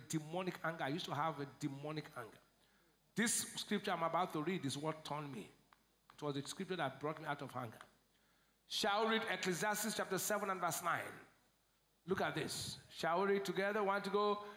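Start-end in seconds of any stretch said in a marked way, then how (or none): none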